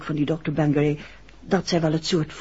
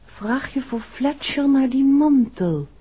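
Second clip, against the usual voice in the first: higher, slow, put-on voice repeating his words; quoting Ted Engels; 7 semitones higher, slower than usual. To Paul, repeating what Ted Engels said, in a slow put-on voice. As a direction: mimic his advice slowly, in a voice not her own. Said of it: "wat die Ted Engels gezegd heeft".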